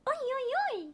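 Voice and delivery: high, put-on oi oi oi; sing-song comic exclamation; a playful Norwegian oi oi oi; mock Norwegian oi. Shown as a demonstration.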